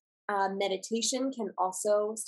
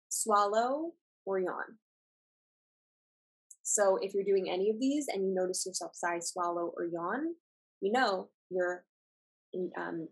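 ordinary talk, nothing marked

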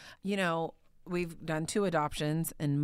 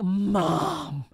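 put-on louder call of a little girl; child calling mom; 5 dB louder than usual. Daughter bursting in to tell her mom, louder call.

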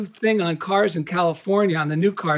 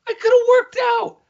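much higher, louder and quick mock complaint; imitating his wife; 4 dB louder, faster than usual. Husband mimicking his wife's lost workout, high mock whine. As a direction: squeak out her frustration as a lighthearted impression.

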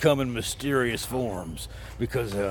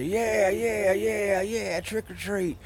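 mock kid voice; 7 semitones higher, slower than usual. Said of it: "And if they say".

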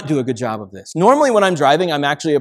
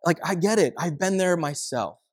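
ordinary talk

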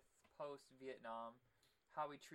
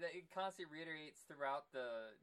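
ordinary delivery

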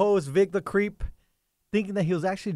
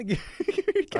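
laughing through the line; 10 semitones higher, 3 dB quieter, faster than usual. Real, not acted. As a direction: repeat it while laughing. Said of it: "So give me your can".

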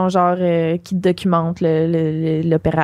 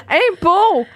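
laughing; 10 semitones higher, 4 dB louder than usual. Real, not acted. Friends laughing at the story.

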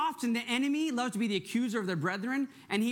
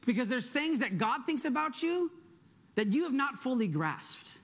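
ordinary delivery

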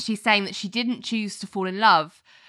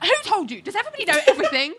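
imitated flustered outburst; 11 semitones higher, much faster than usual. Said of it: "And she's like".

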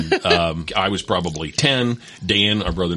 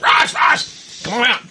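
calling out; slower than usual. Retold or imitated. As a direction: yell for little brother to come out. Kids calling brother outside.

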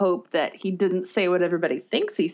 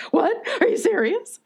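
retold disbelief; 13 semitones higher than usual. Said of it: "And you're like".

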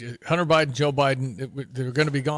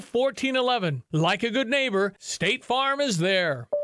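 ad read; 5 semitones higher than usual. Acted. Reads live insurance ad.

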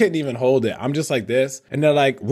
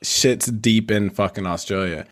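ordinary talk, nothing marked

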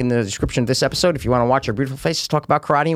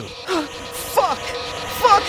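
high, breathy, scared curse; dreading curse; 4 dB quieter than usual. Acted. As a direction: brace for the worst. About to be sprayed, dread.

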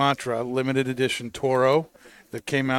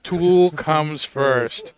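excited; 3 semitones higher, 6 dB louder, much slower than usual. Real, not acted. Fan declares band priority.

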